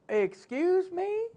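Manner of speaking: high, slow drawn-out retort; indignant. Retold or imitated. Preacher retorting indignantly to a mocking skeptic. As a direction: pull it out slow and offended.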